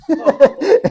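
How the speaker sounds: high loud laugh at his own joke; burst of laughter. Real, not acted. The speaker laughing at his own punchline, loud high laugh. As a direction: crack up loudly.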